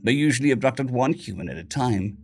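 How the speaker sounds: ordinary delivery, nothing marked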